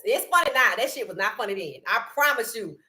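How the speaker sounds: normal talking voice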